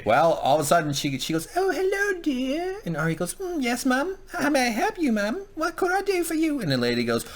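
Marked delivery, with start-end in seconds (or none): 1.6-2.8 put-on very high, sing-out old lady falsetto; gentle old lady. Old lady approaches Arya, put-on sweet falsetto. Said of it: "gentle old lady approaches". 3.4-6.6 very high falsetto imitation of an obliging girl; polite little girl. Arya answers the old lady, put-on polite falsetto.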